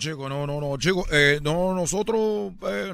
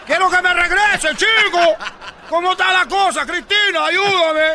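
much higher, loud whiny plea; pleading Elian; 9 semitones higher, 8 dB louder than usual. Retold or imitated. Elian begs Cristina for help, loud high plea. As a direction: beg loudly like a desperate young man. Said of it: "yo soy Elian".

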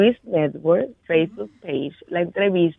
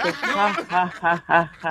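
stiff, evenly spaced "ha ha ha"; mock fake laugh; 3 semitones lower, 4 dB quieter, slower than usual. Caller mocking how the host laughs on his show, fake laugh. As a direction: imitate a forced, fake laugh. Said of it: "hasta cuando se ríe es fake".

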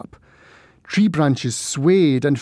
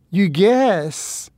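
hysterical and mean; 5 semitones higher than usual. Acted. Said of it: "Her voice sounded hysterical and mean".

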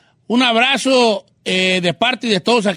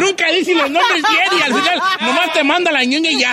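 laughing; 7 semitones higher than usual. Real, not acted. Laughing while teasing a co-host.

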